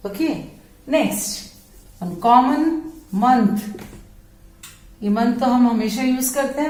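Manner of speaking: normal talking voice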